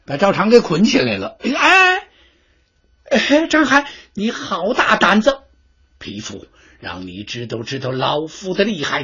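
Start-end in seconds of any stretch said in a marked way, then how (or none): 1.4-5.4 high, shrill indignant cry; a pampered official shocked and yelling; outraged envoy. Acted. Bound envoy cries out at the general, high indignant shout.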